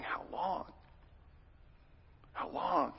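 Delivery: soft, breathy plea of grief; hushed lament. Acted. Pastor voicing the congregation's grief to God, hushed. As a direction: let it fall to a near-whisper, aching.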